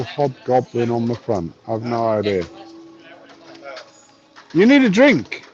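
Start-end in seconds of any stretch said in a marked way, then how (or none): 4.5-5.4 high, loud and emphatic; loud insistent. Pretend. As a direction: push it at them, loudly. Mock insistence.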